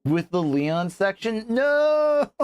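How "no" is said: sudden high-pitched cry of protest. Real, not acted. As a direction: yelp out the no. Sees the Leon section end.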